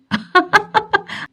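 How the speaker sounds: can't stop laughing at the memory; helpless laughter. Acted. Husband laughing at his wife's words, bursting out. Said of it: "పడి పడి నవ్వుతున్నాడు".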